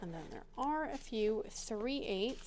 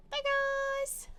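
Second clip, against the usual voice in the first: very high, far squeaky goodbye; squeaky bye; 4 dB louder than usual. Real, not acted. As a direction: squeak the farewell like a cartoon. Sign-off to viewers.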